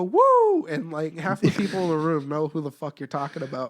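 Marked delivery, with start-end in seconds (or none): 0.0-0.7 high whooping woo, loud; Ric Flair woo. Retold or imitated. At a crowd, a loud high wrestling woo. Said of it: "you go".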